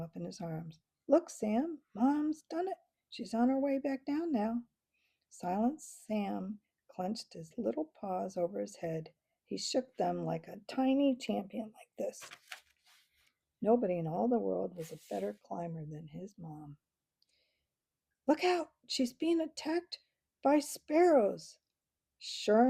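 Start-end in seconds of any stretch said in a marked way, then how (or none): 1.1-4.6 excited. Acted. Mr Squirrel shows Sam his mom. 18.3-21.6 high, urgent cry of alarm; alarmed warning. Acted. Onlooker warns everyone the climber is under attack, alarmed high cry.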